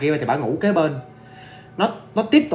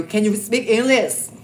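mock scared question; 5 semitones higher, much slower than usual. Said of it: "má này sợ quá, không lẽ giờ má này kêu".

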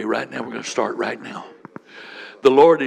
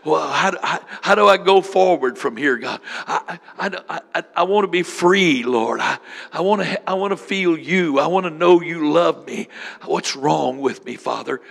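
higher, louder stammering plea to God; anguished prayer; 5 semitones higher, 4 dB louder than usual. Retold or imitated. A hurting believer crying out to the Lord, anguished pleading. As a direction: beg God, stuttering with need. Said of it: "This is the answer".

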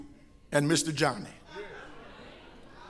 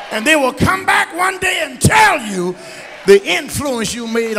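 loud, high preaching cadence; preaching at full force; 5 semitones higher, 6 dB louder than usual. Acted. Pastor encouraging childless women, preaching loud. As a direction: pour it out over the pews.

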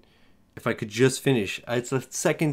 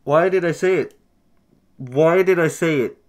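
raised, higher cry of regret; cursing yourself, pitched up and louder; exasperated outburst; 3 semitones higher, 5 dB louder than usual. Real, not acted. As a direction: wail it in real frustration. Just jinxed himself and lost.

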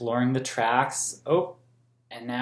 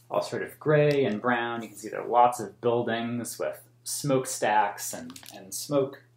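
ordinary delivery

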